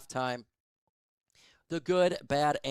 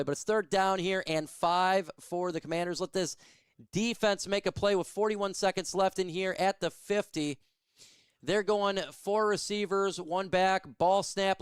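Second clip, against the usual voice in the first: brisk, lightly raised call of each snap; live play-by-play; 4 semitones higher than usual. Acted. Fan calling the game for his stream, play-by-play style. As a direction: narrate the play like a radio caller.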